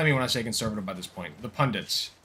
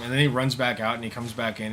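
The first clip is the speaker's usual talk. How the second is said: ordinary talk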